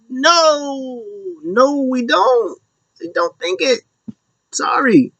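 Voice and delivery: high, slow squeaky denial; mock no. Crowd answering Sami, high put-on denial. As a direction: drag out a high apologetic no. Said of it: "And it's like".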